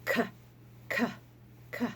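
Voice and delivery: short kicking k sounds; letter sound k. Shown as a demonstration. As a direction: kick out each "K" sharply. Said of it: "She says".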